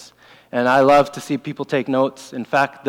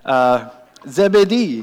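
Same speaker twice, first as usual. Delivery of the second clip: put-on French Canadian accent; 2 semitones lower, slower than usual. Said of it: "fun to say with a French Canadian accent".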